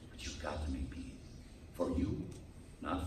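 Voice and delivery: a soft whisper, low and intimate; whispered earnest urging; whispered plea. Real, not acted. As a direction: whisper it like a secret. Pleading with the congregation.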